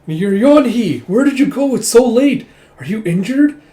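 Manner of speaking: loud, high, anxious scolding; a mother frightened her daughter came home late; worried mother scolding. Retold or imitated.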